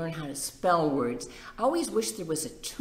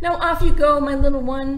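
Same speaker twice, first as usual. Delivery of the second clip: higher, louder proud call; cheery send-off; 10 semitones higher, 13 dB louder than usual. Acted. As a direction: call the little one off proudly. Daddy sends chick off.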